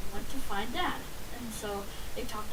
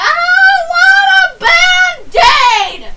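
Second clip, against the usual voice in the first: much higher, much louder, slow wailing scream; crying shout; 21 dB louder, much slower than usual. Retold or imitated. Little boy screaming at his mom for a Band-Aid, loud slow crying wail. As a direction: bawl it out at full force like a toddler tantrum. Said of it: "And I was still crying".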